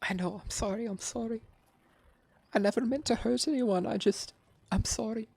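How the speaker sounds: high pleading sorry; tearful apology. Acted. Captive sobbing his apology to Petal. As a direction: beg forgiveness through tears.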